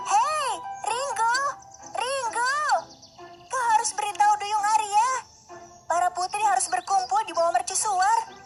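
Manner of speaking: very high princess voice calling a bird; high, ringing call to a messenger. Acted. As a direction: draw out the name, then deliver the message high. Princess calls to seagull Ringo.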